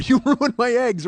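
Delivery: high, loud, drawn-out mock accusation; mock outraged accusation. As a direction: belt it out, laughing at your own bit. Quoting his own old show line.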